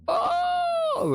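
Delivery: sudden very high squeaky oh; a chipmunk yelp on oh; glitch squeal. Real, not acted. Pressing play, mic glitch turns his oh into a squeak.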